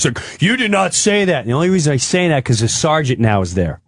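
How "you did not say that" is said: higher, insistent mock denial; indignant denial. Cop denies being told of the threat, mock indignant. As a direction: deny it firmly as the cop.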